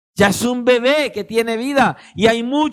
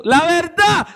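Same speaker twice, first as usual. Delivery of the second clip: high, louder urge; Holy Spirit prompting; 7 semitones higher, 3 dB louder, slower than usual. Acted. Holy Spirit pressing the believer, high and insistent. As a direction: push the truth insistently.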